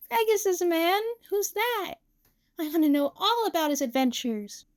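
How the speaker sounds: put-on high, curious little-girl voice; small, excited child; little Saki, delighted. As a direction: ask with a child's eager wonder.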